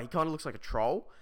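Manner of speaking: usual speaking voice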